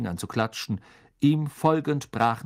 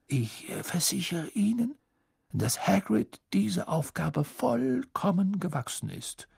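reassuring headmaster; 8 semitones higher than usual. A narrator's reading. Dumbledore assures.

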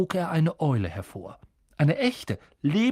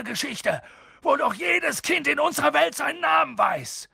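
higher, rising to a shout; indignant disbelief; outraged Hagrid; 9 semitones higher than usual. A narrator's reading. Hagrid fumes that Harry doesn't know his story.

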